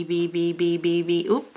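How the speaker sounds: normal talking voice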